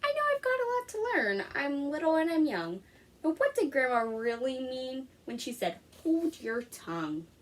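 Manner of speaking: lively picture-book read-aloud; reading to children. Acted. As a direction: read it to a class of young kids. Teacher reads picture book aloud.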